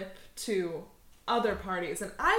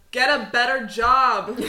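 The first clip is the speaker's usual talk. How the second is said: yelling after him, louder and laughing; 3 semitones higher, 12 dB louder than usual. Acted. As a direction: shout it down the street. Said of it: "She yells after him".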